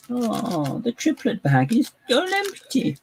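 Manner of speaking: very high, drawn-out comic voice; silly, insane voice. Pretend. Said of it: "any of these voices just seem insane".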